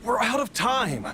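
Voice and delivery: high-pitched, desperate refusal; urgent protest. Acted. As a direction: plead urgently against being left behind. Patient urgently refuses to stay behind.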